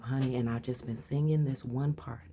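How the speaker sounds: ordinary talk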